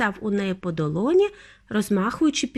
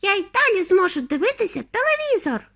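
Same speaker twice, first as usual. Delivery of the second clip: high, defiant tiny squeal; squeaky little protest; thin squeaky voice; 11 semitones higher than usual. A narrator's reading. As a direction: pipe back like a teeny boy. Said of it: "пискнув тоненьким голосочком".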